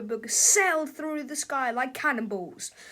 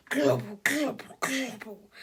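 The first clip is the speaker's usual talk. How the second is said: wet globble noises fading; gobbling sound; slower than usual. Pretend. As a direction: gulp the monsters down.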